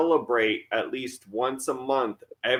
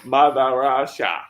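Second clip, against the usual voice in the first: slow, louder mock Russian; mock Russian accent; 5 dB louder, slower than usual. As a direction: declare it with a put-on Russian accent. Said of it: "We make a lot of Russian joke".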